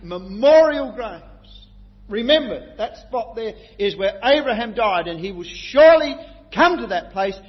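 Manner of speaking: loud, high preaching, building with fervour; full-force preaching. Acted. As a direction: preach it out to the hall with conviction.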